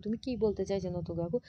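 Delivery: plain reading voice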